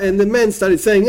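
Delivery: normal talking voice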